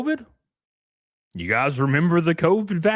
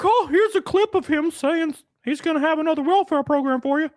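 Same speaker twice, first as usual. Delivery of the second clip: high, sing-song mock of a shrugging reply; mocking dismissal; 10 semitones higher than usual. Media shrugging off the fraud story, high mocking voice. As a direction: sneer at the lame excuse in a put-on voice. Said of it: "They're just like".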